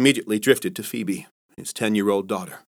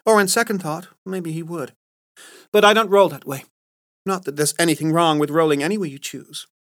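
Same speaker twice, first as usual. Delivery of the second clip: teasing colleague, voice pitched up; a sharp-tongued saleswoman ribbing the guys, lightly higher; Carla voiced higher; 8 semitones higher than usual. Carla's lines in the story.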